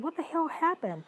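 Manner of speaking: high, loud disbelieving cry; shocked outcry. Retold or imitated. Retelling how she reacted to her friend, shocked and loud. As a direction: blurt it out in horror, pitched high and loud.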